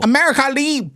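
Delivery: put-on very high, bouncy Vietnamese accent. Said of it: "It's bouncy".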